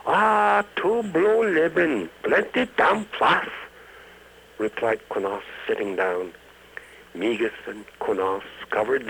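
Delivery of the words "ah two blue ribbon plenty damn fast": high, loud fake Chinese accent, slow bossy order; mock Chinese accent. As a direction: Konos orders beers from the cowed bouncer, fake Chinese accent. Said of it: "finest Chinese inflection".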